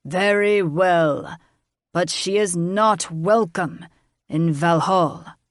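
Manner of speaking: slow, grudging concession; grudging dragon king. A narrator's reading. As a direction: give in grudgingly. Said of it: "Tormund snorted steam".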